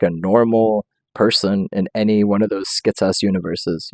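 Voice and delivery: normal talking voice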